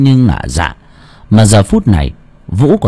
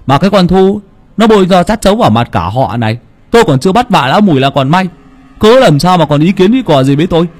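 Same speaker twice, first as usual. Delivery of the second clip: higher, fast bragging retort; gruff boastful scolding; 6 semitones higher than usual. Acted. Clan head scolds his wife about the funeral, fast swaggering retort. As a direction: put her in her place, swaggering.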